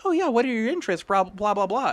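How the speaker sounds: high, fast mock woman's voice; lady's voice. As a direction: squeak it out like an eager date. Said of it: "she's like".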